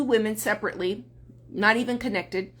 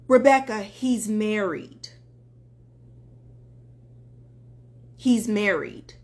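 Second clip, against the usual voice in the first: heartbroken, retold; 3 semitones lower than usual. Woman telling her coach bad news, retold heartbreak. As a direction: lower voice after the name, slowing down. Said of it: "she's just heartbroken".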